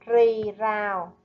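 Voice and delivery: Rì rào slowly, with a clear R on both syllables; careful pronunciation. Shown as a demonstration. Teacher slowly modelling Rì rào for pupils. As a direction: model Rì rào, a distinct R each time. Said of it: "chú ý cách phát âm ở âm R".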